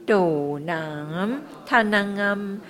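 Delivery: 嘟囔他囔啊 slow, each 囔 held long; long vowel demo. Shown as a demonstration. Teacher modelling mantra sounds for students, slow and held. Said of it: "这个有长音了".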